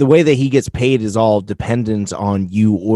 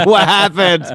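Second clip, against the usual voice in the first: high laughing voices; 10 semitones higher, 5 dB louder, slower than usual. Real, not acted. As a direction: crack up while talking. Both laughing at the joke.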